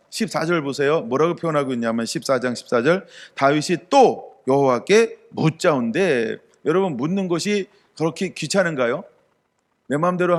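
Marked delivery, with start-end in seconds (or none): 3.3-6.4 slow scripture reading. Acted. Reads scripture verse slowly.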